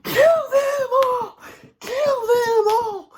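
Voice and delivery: very high, loud, slow cheer; stretched-out manic screech; Pennywise. Retold or imitated. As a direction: cheer in a shrieking clown voice. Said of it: "clapping and bouncing and cheering".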